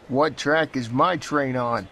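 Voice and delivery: lightly voiced puzzled man; a hint of a confused commuter; mildly bothered passenger; another traveller. A narrator's reading. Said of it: "asked another man".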